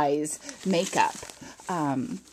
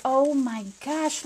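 ordinary talk

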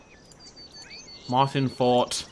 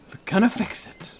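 high but more controlled promise; restrained insistence; 8 semitones higher, 3 dB louder than usual. Pretend. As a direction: insist brightly without shouting. Repeats the promise less frantically.

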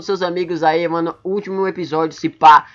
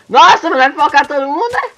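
sudden excited cry; excited exclaim; 8 semitones higher, 6 dB louder than usual. Real, not acted. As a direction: burst out with excitement. Excited outburst in a fight.

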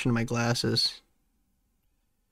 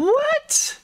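shocked squeal. Real, not acted. Astonished reaction.

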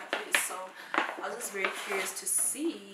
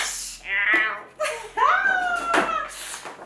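high, loud laugh breaking into a cry; 13 dB louder than usual. Real, not acted. Amused at crying over a gift, laughing and sobbing. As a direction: laugh through tears. Laughs at her own emotion.

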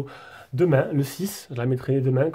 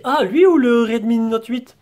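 imitating a viewer; 7 semitones higher, 4 dB louder, slower than usual. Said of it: "On va me poser".

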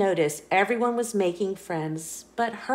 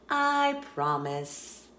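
a slow, hushed promise to little listeners; gentle promise; 4 dB quieter, slower than usual. Real, not acted. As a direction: settle their fears slowly. Reassuring kids about T-Rexes.

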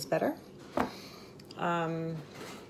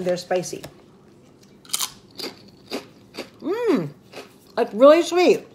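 chewing while talking; muffled by food; mouth full; 3 semitones higher, 6 dB louder than usual. Real, not acted. Seen 1: Chews chips with closed, pursed mouth; hand brings more chips throughout.

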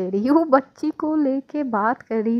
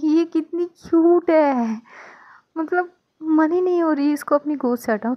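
gushing; 6 semitones higher than usual. Acted. Mother can't let the baby go, adoring. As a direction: savour every word of adoration.

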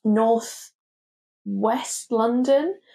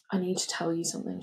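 tense quiet confession; 9 dB quieter, faster than usual. Retold or imitated. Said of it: "I was like".

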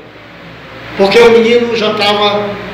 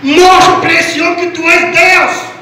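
crying out to God; 5 semitones higher, slower than usual. Retold or imitated. Preacher retelling his plea for his grandson, crying out to God. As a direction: call out slowly and forcefully to the Father. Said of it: "Eu comecei a clamar a Deus".

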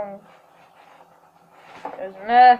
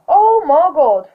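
surprised; 5 semitones higher, 8 dB louder, slower than usual. Real, not acted. Surprised at a pull.

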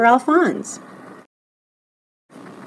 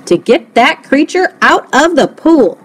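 loud, higher, emphatic order; upset cry; 5 semitones higher, 5 dB louder than usual. A narrator's reading. Upset coach orders creature removed, crying out. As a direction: punch each word out, upset. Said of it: "he cried".

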